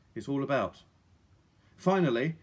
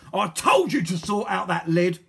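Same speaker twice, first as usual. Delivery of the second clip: loud, raised villain's telling-off; angry scolding; 4 semitones higher, 7 dB louder than usual. A narrator's reading. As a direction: berate a useless accomplice. Villain scolds careless accomplice.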